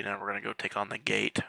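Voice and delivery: ordinary talk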